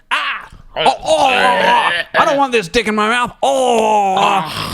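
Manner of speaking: a drowning farmer choking and protesting in panic; panicked farmer. Acted.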